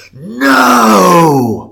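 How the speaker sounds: long, loud, high wailing shout; angry moaning voice. Retold or imitated. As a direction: moan it out angrily at full volume. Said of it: "with this angry, moaning voice".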